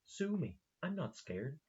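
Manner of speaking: retold defiant dare, pitched high; deadpan dare. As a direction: act out a cocky, unbothered dare. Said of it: "like, deadpan straight to camera".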